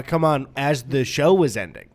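ordinary talk

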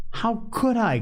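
high, indignant outburst; indignant cry. A narrator's reading. Piggy protests to Ralph, high indignant cry. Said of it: "cried Piggy indignantly".

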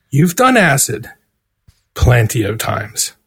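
a hint of a calm friend on the phone; calm reassuring publicist. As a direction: talk him down gently, slowly.

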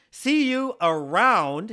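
around drawn out slow and loud; stress on around. Retold or imitated. Reading a friend's teasing letter aloud, stressing around. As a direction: lean hard on around, stretched. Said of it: "he emphasizes the word round".

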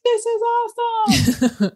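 high, slow, sing-song "This is awesome"; drawn-out giddy delight, mocking her old self; mock chirpy self. To the podcast host, mock happy past self. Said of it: "you're like".

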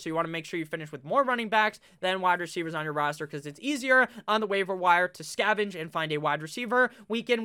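usual speaking voice

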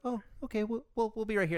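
pitched well up, sweet and passive; high customer voice. Retold or imitated. Barista mocks customers settling in to wait, high meek voice. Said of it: "And they're like".

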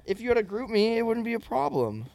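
imitating own incredulous protest, pitch climbing; exasperated comeback. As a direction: tell off a drunk accuser, half amused. Retold exasperated reply to drunk accuser.

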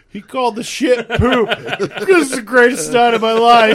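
loud, high, real cracking up; laughing hard. Real, not acted. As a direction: shout it through helpless laughter. Cracking up over favourite line.

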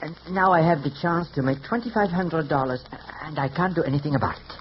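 put-on French-accented artiste hairdresser; phony French accent. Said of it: "without the phony French accent".

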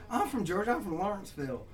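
a hint of an excited girl; imitated girl. Girl tells him her hometown, lightly imitated excitement. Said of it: "She's like".

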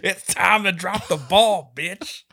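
milk the cheesy catchphrase; action hero one-liner. Pretend. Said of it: "New Year's Eve one-liners".

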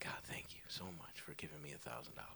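quick hushed prayer of gratitude; whispered thanks. Retold or imitated. Thanking God in bed for saved money, whispered fast. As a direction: breathe it out as a private whisper.

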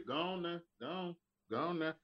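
lightly voiced warning; mock Southern warning. A Southern man waving you off. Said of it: "when you hear".

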